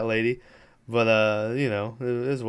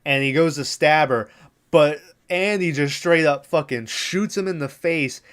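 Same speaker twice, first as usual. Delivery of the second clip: ordinary talk, nothing marked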